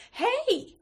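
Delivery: high-pitched happy holler; joyous lilting call. A narrator's reading. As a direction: call out as if you just found something wonderful. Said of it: "strangely joyous, lilting quality".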